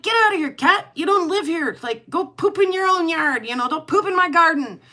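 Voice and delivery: high, loud mock scolding; loud, high scolding at a cat; shooing the cat. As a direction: chase the stray cat out of the yard. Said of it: "I'm like".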